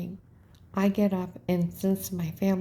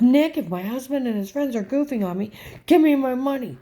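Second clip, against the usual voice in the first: louder, higher, exasperated retold demand; loud insistent demand; 5 semitones higher, 6 dB louder than usual. Customer pressing teller Nick, loud escalating demand. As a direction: build to a raised, high command.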